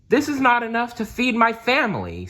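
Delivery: high, pleading voice of a tired worker; poor worker complaining. Acted. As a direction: beg like a hungry worker who is underpaid. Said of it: "said one worker".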